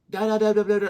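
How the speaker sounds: high, loud nonsense syllables; mimicked gibberish. Speaker showing how foreign language sounds, mock gibberish.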